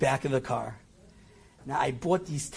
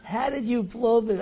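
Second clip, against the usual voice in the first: exasperated self-rebuke; 7 semitones higher than usual. Retold or imitated. Louder repeat of self-reproach.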